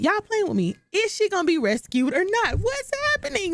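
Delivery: building up to a disbelieving yell; rising excited disbelief. Real, not acted. Vlogger on the edge of her seat, climbing to a shout. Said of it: "I'm on the edge of my seat".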